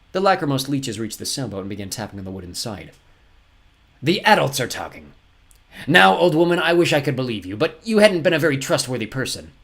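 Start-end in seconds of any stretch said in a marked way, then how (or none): 4.0-9.5 louder, higher villain voice; condescending villain captain. Acted. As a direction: put children and woman in their place.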